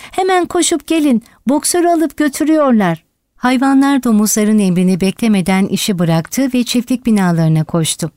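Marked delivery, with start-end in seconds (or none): 0.1-3.0 high, alarmed call without real volume; urgent cry. A narrator's reading. Benjamin warning that Boxer is being taken, urgent cry. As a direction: raise the alarm, pitched well up. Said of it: "diye bağırıyordu Benjamin".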